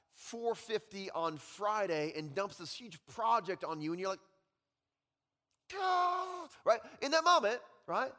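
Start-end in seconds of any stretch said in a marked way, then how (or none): 5.7-6.5 sudden high exasperated groan; mock frustrated groan. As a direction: groan at the Friday dump. Reacting to boss dumping project.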